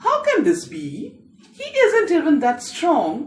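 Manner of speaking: high-pitched, incredulous protest; railing. A narrator's reading. Elder brother doubting the boy's feat, railing. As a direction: scoff in high-voiced disbelief. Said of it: "Dada would rail".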